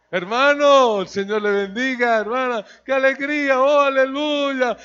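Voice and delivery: loud, high, beaming church welcome; loud put-on joy for the flock; fake cheerful greeting. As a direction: overdo the holy smile.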